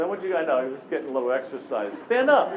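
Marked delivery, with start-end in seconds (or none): 2.1-2.5 insistent call. Real, not acted. Coaches, seriously.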